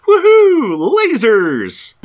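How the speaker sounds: high, loud mock-excited whoop; drawn-out, playful cheer; gleeful whoop. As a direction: whoop it out like a kid firing lasers. Acts out gleeful title of panel.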